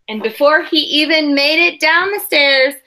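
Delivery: put-on expressive read-aloud for children; storybook reading voice; story narration. A narrator's reading. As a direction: read the picture book to the class with care. Teacher reads picture book aloud.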